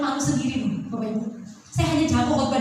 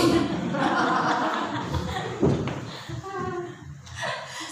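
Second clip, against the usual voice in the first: soft breathy laugh; 3 semitones higher, 6 dB quieter than usual. Retold or imitated. Laugh after retold quote.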